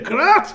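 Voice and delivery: sudden high "crash" burst; sharp comic sound effect; imitated crash. Sudden event imitated with a noise.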